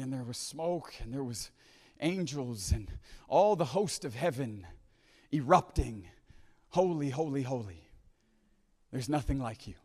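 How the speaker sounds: low, very soft reverent recital; hushed scripture recitation. Acted. Preacher recounting Isaiah seeing the Lord enthroned, hushed recitation. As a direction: let each phrase hang in awe, with long pauses.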